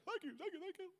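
high, breathy-free mock gushing thank-yous. Preacher mocking a gushing award winner, high and thrilled. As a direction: gush like an overwhelmed winner.

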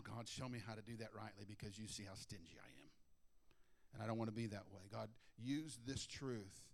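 low, soft confessional prayer; quiet prayer. Shown as a demonstration. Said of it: "I just stop and say".

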